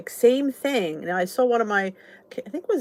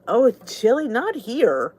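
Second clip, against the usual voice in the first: ordinary talk, nothing marked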